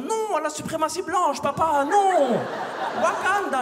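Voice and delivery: put-on high, loud squeaky kid protest; high-pitched rebellious son. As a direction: shriek it like a rebellious teen. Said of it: "il va me dire".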